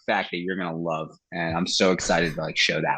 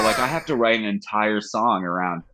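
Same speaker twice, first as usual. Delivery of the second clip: usual speaking voice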